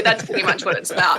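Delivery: normal talking voice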